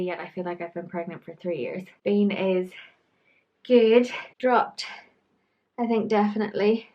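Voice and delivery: ordinary delivery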